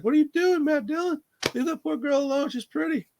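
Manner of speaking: whiny falsetto plea, held high throughout; high-pitched mock protest. Viewer telling Matt Dillon off, high mock protest. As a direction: scold in a hammed-up high voice. Mock scolding of the film's killer.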